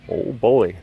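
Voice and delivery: usual speaking voice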